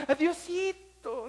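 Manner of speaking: high, slow, loud pleading to God; hammed-up plea to God; mock pleading prayer. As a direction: plead like a desperate believer.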